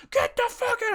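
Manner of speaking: high, sudden shout of an enraged dad; mock furious yell. Dad terrifying his kid, yelling. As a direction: burst out yelling in imitation.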